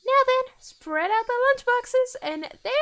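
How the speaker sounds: put-on loud slow girlish call; high girl voice. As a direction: announce like a bossy girl. Girl commands loudly, slow.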